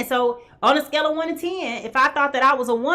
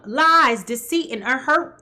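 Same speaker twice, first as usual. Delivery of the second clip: ordinary talk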